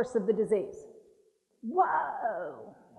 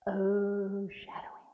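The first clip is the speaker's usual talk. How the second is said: breathy hushed shadowing; soft whispered aside; 4 semitones lower, 7 dB quieter, much slower than usual. Real, not acted. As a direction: drop to a whisper.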